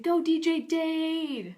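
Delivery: high, drawn-out cartoon cheer; stretched-out crowd shout. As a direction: whoop it up for the DJ, loud and slow. Character cheering the DJ.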